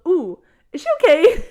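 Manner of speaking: loud, high peppy morning voice. Retold or imitated. Teacher walks in on an upset student, peppy. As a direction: imitate an over-chirpy teacher, laughing. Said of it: "peppy in the morning".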